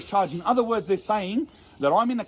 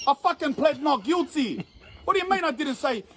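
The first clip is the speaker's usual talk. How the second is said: indignant protest; 7 semitones higher than usual. Retold or imitated. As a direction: lean hard on each word, voice climbing. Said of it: "And I'm like".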